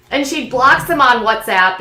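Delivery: ordinary delivery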